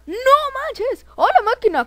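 high, loud exclamation; swooping, excited cry; astonished shout. Pretend. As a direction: yell in amazed disbelief. Astonished by game event.

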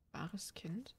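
breathy whisper, rising at the end; hushed, uneasy whisper; whispered question. Acted. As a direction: whisper it like a secret you dread. Child's unsettling line read.

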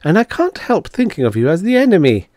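high, lightly plaintive confession; miserable. A narrator's reading. Woman admits her distrust to the Devi, mildly miserable. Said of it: "Miss Twilly said miserably".

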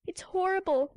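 little seal pleading with the sea lion; distressed pup cry. Acted. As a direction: wail for help from the water. Pup horrified by killing.

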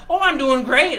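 loud, high enthusiastic reply. Acted. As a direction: answer like a delighted diner, loud and high. Diner guest answers waitress in story.

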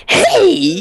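high, loud hammed-up hello; loud greeting. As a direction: yell it like a hyper YouTuber. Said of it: "But then when she's recording, she's like".

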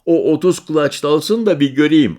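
put-on high, loud bragging boy; boastful child. Boy boasting to the stranger about his father, high and loud. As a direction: brag about your father like a kid.

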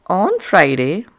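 ordinary delivery, nothing marked

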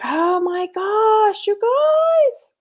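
squeaky high excited voice; voice cracking up an octave. Real, not acted. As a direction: pitch jumps way up in excitement. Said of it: "my voice just went up the, the octaves".

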